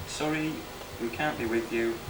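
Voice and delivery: ordinary talk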